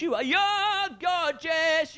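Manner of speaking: slow, high, hammed-up shout; mock gushing shout. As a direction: gush loudly at the audience, drawing out each word. Comic gushing at front-row audience member.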